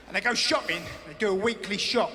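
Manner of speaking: ordinary delivery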